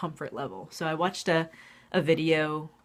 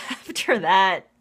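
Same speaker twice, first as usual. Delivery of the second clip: normal talking voice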